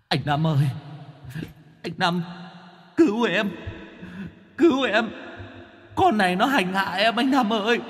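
high, pleading wail from the mirror; desperate cry for help. Acted. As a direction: cry out to be rescued, pitiful. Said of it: "gào lên".

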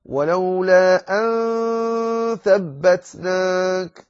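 ولولا أن ثبتناك with the nasal نّ hidden before ث, slow and high; very slow chanted أن with light ghunna; Quranic recitation with ikhfa. Shown as a demonstration. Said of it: "من الأمثلة على ذلك قول".

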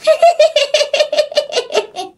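very high, stuttering spooky cackle; eerie ghostly laugh. Acted. Dead woman laughing at a drunk neighbour, eerie high cackle. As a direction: let out a high, creepy ghost giggle. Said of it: "Nó nói vậy nè".

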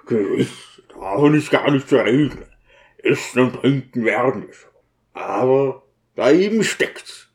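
lightly gruff character voice; a touch of brooding deacon; grieving deacon, conceding. Acted. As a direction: concede wearily, then hold back the real trouble.

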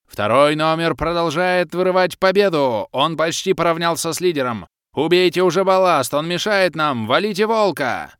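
high, loud race-commentator calls; азартный комментатор. A narrator's reading. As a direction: call the race like a thrilled announcer. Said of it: "всё комментирующая". (Кэлен азартно комментирует гонку, звонко и громко)

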